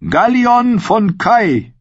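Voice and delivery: high, very drawn-out name announcement; ringing ceremonial call; herald's call. A narrator's reading. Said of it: "rief einer von ihnen".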